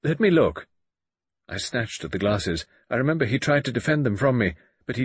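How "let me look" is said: high, loud curt command; peremptory. Acted. Narrator demands the glasses, curt and peremptory. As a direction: bark an order. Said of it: "I said peremptorily".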